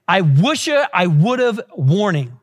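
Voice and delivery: slow, spaced-out "I wish I would've warning"; slow phrasing. Shown as a demonstration. Preacher introducing a warning to young people, slowly and deliberately. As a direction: draw out "I wish I would've", then drop on "warning". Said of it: "Say that really fast".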